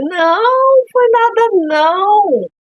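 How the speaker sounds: high, drawn-out reassuring hostess voice; gracious hostess. Acted. Hostess to visiting child who broke a plate, overly gracious. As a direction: sing out exaggerated reassurance to a guest. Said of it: "anfitriã".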